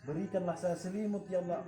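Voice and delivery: soft, low murmured Islamic prayer recitation; a healer praying over a sick man. Real, not acted. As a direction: recite it devoutly under your breath.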